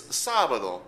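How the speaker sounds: sábado punched on the opening SÁ; stress on first syllable. Shown as a demonstration. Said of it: "we write in an accent to show".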